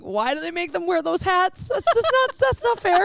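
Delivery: high squeal through helpless laughter; wheezing laughter. Retold or imitated. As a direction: barely get the words out, wheezing with laughter. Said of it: "she's wheezing, like, uncontrollably".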